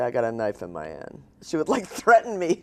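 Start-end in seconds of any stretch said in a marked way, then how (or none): 0.0-1.2 dropped H's, low and matter-of-fact like an immigrant mother; mother's accent. Retold or imitated. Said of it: "she dropped her H's like a Cockney".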